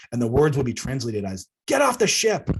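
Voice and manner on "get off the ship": high, loud commanding yell. Retold or imitated. Stern elder orders Jeff off her ship, loud yell. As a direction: blast him off the ship with one order. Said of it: "be consistent with us yelling".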